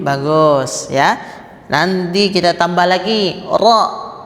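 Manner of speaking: ordinary delivery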